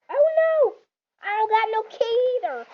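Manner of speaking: high, loud cry of dismay; dismayed shout. Real, not acted. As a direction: yelp it out in shock. Character dies in the game.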